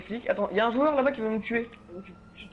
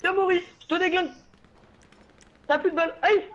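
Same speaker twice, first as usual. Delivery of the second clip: trash talk taunting; 7 semitones higher than usual. Real, not acted. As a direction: hype taunt at the enemy. Taunting an enemy player.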